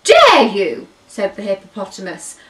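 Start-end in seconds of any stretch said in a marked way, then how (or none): 0.0-0.9 high, loud, slow hippo outburst; slow shrieking how dare you; outraged shout. Acted. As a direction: blast it out in offence. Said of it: "and shouted at him some more".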